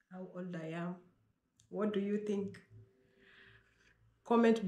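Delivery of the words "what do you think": ordinary talk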